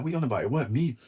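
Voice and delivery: high-pitched, incredulous comeback; retold indignant protest. Defendant answering probation officer about remorse, incredulous protest. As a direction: protest your innocence, pitch jumping up.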